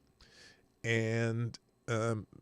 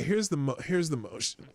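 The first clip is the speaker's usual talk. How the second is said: ordinary delivery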